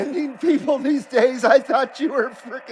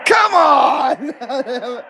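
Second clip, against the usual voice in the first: laughing shout; laughing outburst; 4 semitones higher than usual. Real, not acted.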